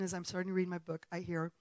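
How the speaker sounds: ordinary talk, nothing marked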